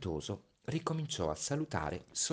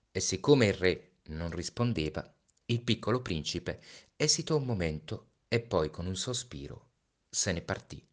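ordinary delivery, nothing marked

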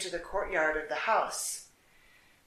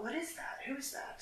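startled wondering; 7 semitones higher, 11 dB quieter than usual. Acted. Wondering alone on the river.

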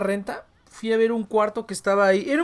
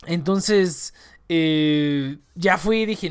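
ordinary talk

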